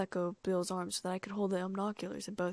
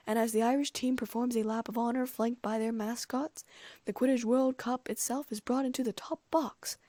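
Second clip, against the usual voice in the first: higher-pitched ceremonial commentary; announcer; 4 semitones higher, 3 dB louder than usual. A narrator's reading. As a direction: announce the trophy to the stadium. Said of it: "Bagman was saying".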